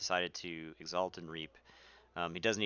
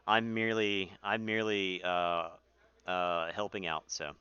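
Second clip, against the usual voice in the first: ordinary delivery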